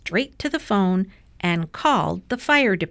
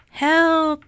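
high, drawn-out call for help; storybook distant yell, pitch way up; faraway shout; 9 semitones higher, 5 dB louder than usual. A narrator's reading. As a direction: cry out as if from far away. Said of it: "shouted a faraway voice".

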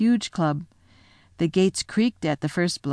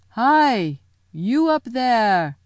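loud, slow roar up at the giant; lion calling up to the giant, slow and loud; 4 semitones higher, 4 dB louder, much slower than usual. Acted. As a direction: bellow up to a giant far above. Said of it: "he roared".

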